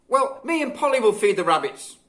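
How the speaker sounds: a mum ending a squabble; motherly. A narrator's reading. Mother settles dispute.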